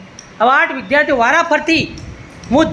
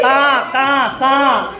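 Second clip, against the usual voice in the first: drawn-out imitated crow call; crow caw; 2 semitones lower, much slower than usual. Shown as a demonstration. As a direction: caw like a crow, stretching each one. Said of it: "બોલો, કાગડાનો". (કાગડાનું કા કા)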